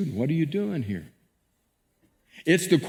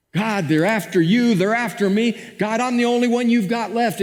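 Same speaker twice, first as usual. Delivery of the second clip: complaining; 6 semitones higher than usual. Acted. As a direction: moan like a hunted man feeling sorry for himself. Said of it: "He complains".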